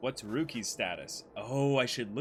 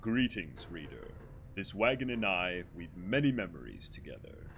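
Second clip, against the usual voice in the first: lower, grave elder voice; low, solemn old man; 5 semitones lower, 4 dB quieter than usual. Acted. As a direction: speak like a weary old exile. Voices Volfred in game.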